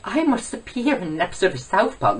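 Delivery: high, whiny mock impression; long high-pitched no, then insist; PewDiePie. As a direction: ridicule a rival in a put-on voice. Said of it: "This is me doing my impression of PewDiePie".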